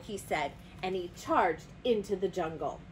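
ordinary delivery

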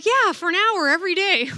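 high-pitched, animated comeback; mock eager reply. Sister replying to the boy, in a high animated voice.

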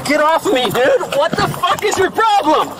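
yelling in real panic; furious shout, fighting back; angry shouting. Real, not acted. Struggling against arrest.

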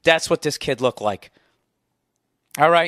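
usual speaking voice

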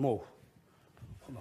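ordinary delivery

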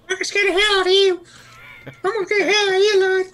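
high, fast sped-up voice; sped up like a chipmunk. Retold or imitated. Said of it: "our voices sound like chipmunk voices".

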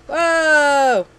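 loud, high drawn-out whoa; startled. Real, not acted. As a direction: cry out in surprise. Startled by game event.

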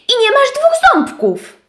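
dużo wyżej, żywo; wyżej, z zapałem; wysoki głos owieczki. Acted. Owieczka wylicza. (owieczka wylicza szczurowi, dużo wyżej)

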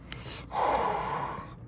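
long breath out in shock; heavy sigh. Real, not acted. Card opener after a huge pull, overwhelmed sigh.

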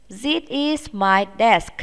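slow, careful drill; slow classroom model; slow model sentence. Shown as a demonstration. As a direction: say it slowly for pupils to copy. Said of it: "Chúng ta sẽ nói".

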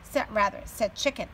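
teacher reading a picture book aloud to her class; reading to children. A narrator's reading. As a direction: read it out with energy for little kids.

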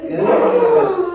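high, loud wail of a vow; desperate shouted promise. Acted. Husband swearing to the goddess with a high loud wail.

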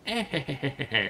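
high, wheezy little gremlin cackle; snicker. Retold or imitated. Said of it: "cringe attempt at doing their laugh".

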